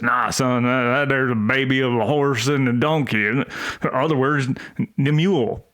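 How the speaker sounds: put-on dad brushing off his son in a Southern accent. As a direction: lay on a relaxed Southern father accent. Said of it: "The accent's good".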